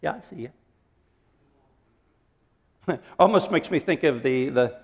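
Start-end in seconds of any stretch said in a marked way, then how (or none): 0.0-3.0 softer, dry, unimpressed; a hint of God chuckling at boasting; dry amused reply. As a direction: answer softly, amused, unbothered. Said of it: "God looks down from heaven and says".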